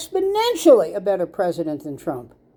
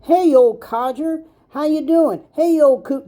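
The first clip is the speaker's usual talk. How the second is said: mock greeting; 8 semitones higher, 4 dB louder than usual. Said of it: "gone up to him and said".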